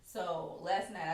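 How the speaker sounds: usual speaking voice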